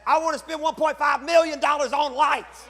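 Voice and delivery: loud, high outburst of wanting; hammed-up craving. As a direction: blurt the wish out big and high. Exaggerated confession of his wish.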